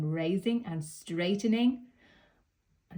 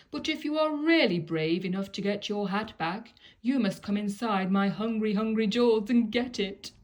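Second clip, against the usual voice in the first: greedy crocodile; 2 semitones higher, 4 dB louder than usual. Acted. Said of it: "drawled the crocodile".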